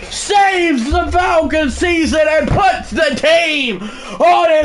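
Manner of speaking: high shouted commentary, building to a roar; excited play-by-play call. Real, not acted. Gamer calling his own game-winning field goal, shouting excitedly. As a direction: call the winning kick like a broadcaster.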